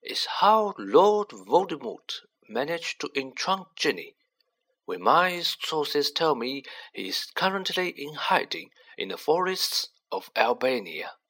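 calm, kindly explanation; gentle old wizard. Acted. Dumbledore continues speaking gently.

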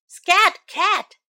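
a busy baker chasing off a cat; baker shooing. Acted.

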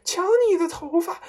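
high, loud scream of alarm; horrified shriek. A narrator's reading. Mother points at her husband's hair, shrieking. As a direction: shriek it in shock. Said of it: "妈妈用发着抖的手指指着她丈夫尖 声叫道".

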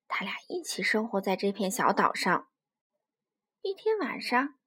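usual speaking voice

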